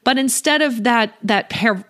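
ordinary talk, nothing marked

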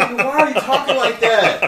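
high, loud laughing outburst; cracking up. Real, not acted. Co-host reacting to the host's silly voice, cracking up loudly. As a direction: shriek it through helpless laughter.

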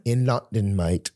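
put-on playful London accent; mock London accent. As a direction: answer low in a fake London accent.

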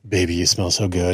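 low, smooth come-on; seductive murmur. Acted. Man sweet-talking during a massage, seductive. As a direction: purr it like a mock seduction.

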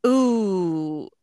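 imitating the vet tech's drawn-out worried ooh, first one loud; a sharp alarmed intake of breath turned into ooh. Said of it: "exactly how she did it".

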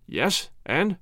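mildly impatient prompt, rising; questioning nudge to get on with it. A narrator's reading. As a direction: press for the rest with understated impatience.

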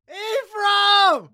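loud, high shout of welcome; host calling his guest onto the show, shouting. Real, not acted. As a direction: hail him like a long-lost friend.

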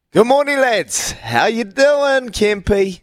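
high, drawn-out morning hello; hearty radio greeting. Real, not acted. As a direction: sing out the greeting to the co-hosts, stretching it high. Host calls greeting to co-hosts.

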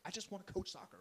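high-pitched mock plea; retold exasperated pleading; pleading protest. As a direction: protest like a put-upon coach. Acts out coach's protest.

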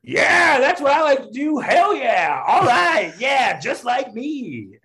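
put-on high, loud drunken yell; heckler yelling. Drunk heckler yelling out mid-set, high and loud. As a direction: bellow it like a rowdy heckler cheering himself on. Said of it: "just yells that out".

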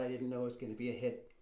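Fredo pleading. Acted. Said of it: "boat scene".

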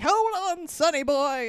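a hint of a blimp captain calling out. Captain calling to boy on the rope, lightly imitated. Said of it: "captain of the blimp is just like".

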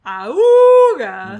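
loud high lustful yelp; a sudden, yelped burst of attraction; hammed-up swoon noise; mock lustful yelp. Reacting to seeing the dad.

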